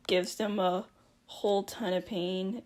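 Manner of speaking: usual speaking voice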